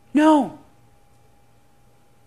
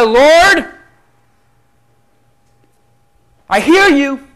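Gideon's plea; 2 semitones higher, 5 dB louder than usual. Acted. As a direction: beg honestly, then hush. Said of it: "He said".

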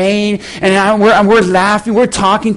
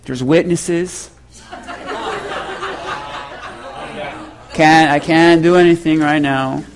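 low, measured self-restraint; restrained aside; 6 semitones lower, 6 dB quieter than usual. Retold or imitated. Pastor holding back before witnesses, restrained. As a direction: talk yourself down, low and deliberate.

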